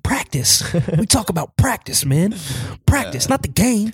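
high, sing-song mocking rant; Allen Iverson impression. Friend mimics the star's press-conference rant, high and sing-song. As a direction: stretch it out slowly in disbelief. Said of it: "I made him, like, this intro".

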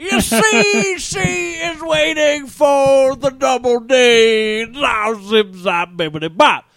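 high, loud, drawn-out comic babble; a hammed-up impression, stretching the words out in a high loud voice; Bill Cosby. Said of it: "My first instinct isn't Bill Cosby, where I'm like".

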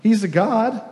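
high, taunting jeer; mocking Elijah. Preacher voicing Elijah taunting Baal's prophets, high mocking jeer. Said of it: "Elijah mocked them".

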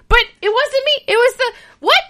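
high, bewildered squeaky protest; hammed-up bafflement; mock confused protest. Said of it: "Cass is like".

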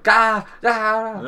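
high loud gah gah gah; imitated fight noise. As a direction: bark out harsh fight noises. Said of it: "He was like".

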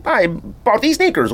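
high, slow robotic voiceover; mock robot voice in a high pitch. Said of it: "whatever that robot voice".

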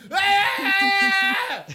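loud, high-pitched mock scream; long yell as if from a speeding car; scream out window. Driver screaming out the window on highway, mock scream. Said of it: "screaming out the window doesn't work".

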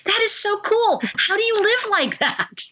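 high-pitched, wowed enthusiasm; thrilled admiration. Retold or imitated. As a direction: marvel at the caller, voice lifted high. Retold admiring reply shocking the studio.

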